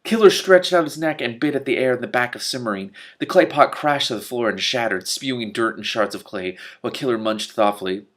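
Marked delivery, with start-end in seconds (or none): none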